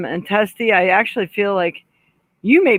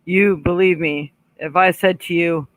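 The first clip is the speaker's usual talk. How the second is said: ordinary talk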